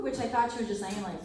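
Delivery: ordinary delivery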